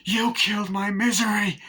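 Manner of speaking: high, loud mock outburst; enraged accusation. Acting out enraged film character.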